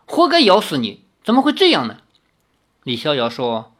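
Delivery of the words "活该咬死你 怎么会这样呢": higher, fretful scolding; acted exasperated concern; cross and anxious. A narrator's reading. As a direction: scold out of worry, voice lifted. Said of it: "真是又气又急".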